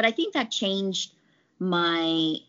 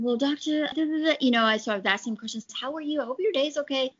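higher-pitched, softened polite questioning; lightly acted sweet politeness, pitched up; retold polite rep; 7 semitones higher, faster than usual. Sales rep asking a grumpy doctor questions, politely.

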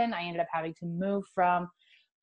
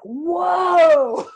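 high, loud drawn-out whoa; overwhelmed by the spirit filling her; amazed whoa; 10 semitones higher, 10 dB louder than usual. Retold or imitated. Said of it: "It was like".

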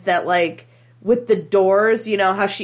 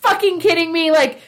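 higher, louder indignant yell; recalling being startled, shouting at the movie; mock outrage; 7 semitones higher, 4 dB louder than usual. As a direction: yell at the screen after a jump scare. Jump scare outrage.

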